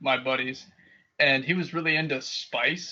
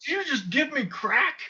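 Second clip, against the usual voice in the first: high, frantic mock panic; freaking out; 9 semitones higher than usual. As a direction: play a dad accusing in terror. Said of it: "My dad's freaking out".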